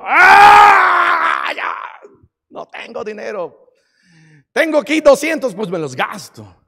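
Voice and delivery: loud cry then reckless mumble; a gambler shrugging off his losses; hammed-up casino loser; broke gambler.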